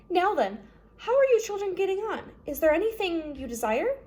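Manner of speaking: a hint of a gracious older lady; Justice Strauss voice. Reader voices the kind judge to the children.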